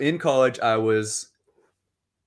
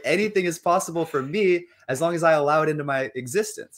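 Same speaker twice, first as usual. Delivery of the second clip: excited and laughing, pitched higher and louder; elated; 5 semitones higher, 4 dB louder than usual. Shown as a demonstration. A joyful person shouting that anything is possible, elated. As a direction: burst out with joy, laughing through it. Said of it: "you're like".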